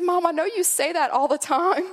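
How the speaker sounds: put-on high, insistent child voice; little boy. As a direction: imitate a four-year-old protesting to mom. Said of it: "He's probably four".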